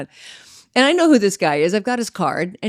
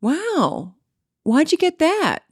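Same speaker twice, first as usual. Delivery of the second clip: retold pointed question; 9 semitones higher, much slower than usual. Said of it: "Today, I'd be like".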